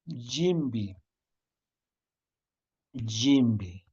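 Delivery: normal talking voice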